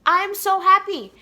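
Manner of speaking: high, loud burst of joy; retold elated outburst; excited mom. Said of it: "I want to scream and yell of excitement".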